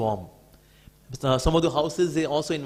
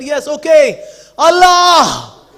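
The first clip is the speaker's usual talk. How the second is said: loud, high forced cry; with all his strength; 10 semitones higher, 17 dB louder, much slower than usual. Retold or imitated. Weak Maharaj giving in to the fanatic, loud cry. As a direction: summon every bit of strength and shout it. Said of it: "gathered all of his strength".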